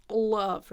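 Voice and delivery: "love" with a heavy dark L at the start; wrong dark L. Shown as a demonstration. Teacher shows what light L isn't, dark-L "love". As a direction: drag out a swallowed L before "love". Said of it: "Doesn't have that".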